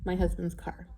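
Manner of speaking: usual speaking voice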